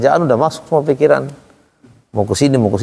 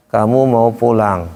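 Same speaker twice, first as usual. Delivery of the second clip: slow, level, no question tune on PULANG; flat intonation; 3 semitones lower, slower than usual. Shown as a demonstration. Teacher shows a question read flat, no intonation. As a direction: drone evenly through MAU PULANG without asking.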